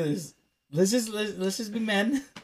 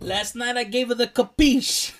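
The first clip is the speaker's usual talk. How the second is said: higher-pitched mock mobster accent; Italian-American mobster accent; 5 semitones higher, 4 dB louder than usual. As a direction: brag in a hammed-up mobster accent. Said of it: "That's why we're doing accents".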